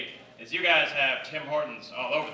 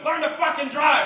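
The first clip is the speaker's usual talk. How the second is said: high, louder imitated road-rage yell; mock angry shout; 12 semitones higher, 5 dB louder than usual. Angry driver shouting through the window, mock yell. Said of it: "shouts through the open window".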